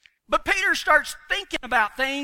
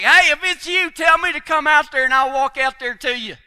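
high, loud, eager call across the water; eager Peter; 5 semitones higher, 9 dB louder than usual. Retold or imitated. Peter shouting eagerly from the boat to Jesus. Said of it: "Peter's like".

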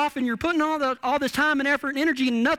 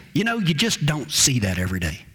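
lightly voiced old rider, low pitch; low, unfazed retelling of an old man's remark; understated old man; 9 semitones lower than usual.

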